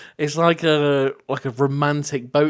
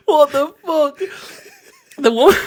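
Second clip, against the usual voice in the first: crying with laughter; 6 dB louder than usual. Real, not acted. Telling a friend about the fake death, crying with laughter. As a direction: squeeze the words out through laughter.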